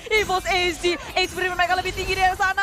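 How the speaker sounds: loud, high hype shouting; fighting game announcer. Real, not acted. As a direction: build to a big climax call. Hype teamfight call.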